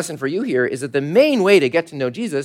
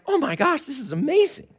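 higher, softer waking awe; amazed Jacob; 11 semitones higher, 4 dB quieter than usual. Retold or imitated. Jacob wakes from the dream in awe. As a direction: gasp it out in wonder. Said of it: "he's like".

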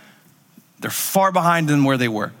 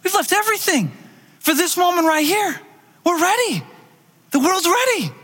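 much higher, near-shouted eagerness; fired-up disciples; 12 semitones higher than usual. Retold or imitated. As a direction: build the urgency as you go. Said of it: "It's like".

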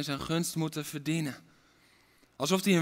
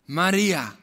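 Jesus calling; 7 dB louder than usual. Acted. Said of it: "En Jezus zei tegen haar".

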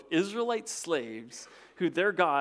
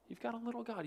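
softer; 7 semitones higher, 10 dB quieter, faster than usual. Shown as a demonstration.

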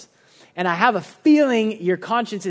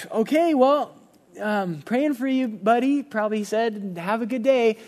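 much higher, awkwardly upbeat pastor; forced cheer; 5 semitones higher than usual. Retold or imitated. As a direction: wrap up a failing call brightly. Pastor ends awkward call.

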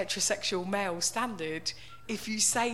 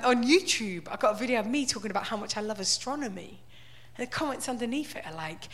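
ordinary talk

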